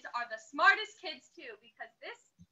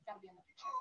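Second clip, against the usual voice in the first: soft whispered aside; quietly aside off mic; 14 dB quieter, much faster than usual. Real, not acted. Aside while arranging family.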